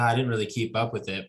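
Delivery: normal talking voice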